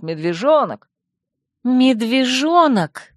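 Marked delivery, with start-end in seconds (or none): 1.6-3.1 slow, higher-pitched mocking echo; indignant, drawn-out quoting; pointed echo. A narrator's reading. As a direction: throw the word back at him, stretched out. Indignantly echoes his word back.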